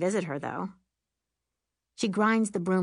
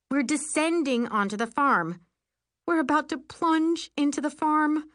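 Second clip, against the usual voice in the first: mounting alarm; 8 semitones higher, 4 dB louder than usual. A narrator's reading. Broom falling toward farm.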